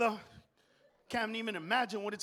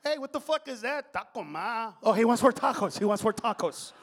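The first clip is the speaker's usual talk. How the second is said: put-on Mexican accent; 4 dB louder than usual. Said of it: "with a Mexican accent".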